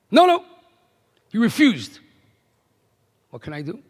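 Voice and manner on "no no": high, loud retold refusal; mock insistent no; emphatic refusal. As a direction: wave the offer off sharply, pitched up. Old man refuses the offer.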